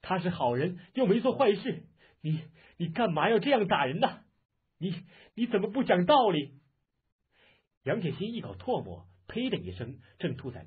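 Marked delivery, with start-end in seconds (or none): 0.0-6.6 loud, high acted wail of protest; wife's anguished outcry, building; crying out. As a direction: plead in a distraught, raised voice. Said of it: "哭叫".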